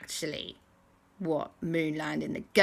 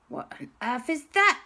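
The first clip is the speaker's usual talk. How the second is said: higher-pitched startled question; 6 semitones higher than usual. Acted. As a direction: ask it in rising alarm. Startled characters hear something stomping.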